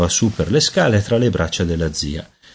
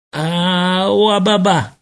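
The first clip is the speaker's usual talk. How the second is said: high-pitched infant babbling; baby babble; 10 semitones higher, much slower than usual. A narrator's reading. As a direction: babble like a baby trying to talk. Baby trying to speak, babbles. (balbettio acuto da neonato)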